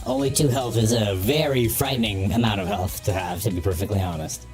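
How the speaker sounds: ordinary talk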